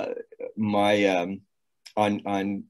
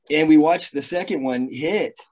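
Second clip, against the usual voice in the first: ordinary delivery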